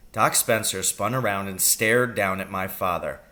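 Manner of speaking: plain reading voice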